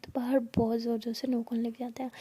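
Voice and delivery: ordinary delivery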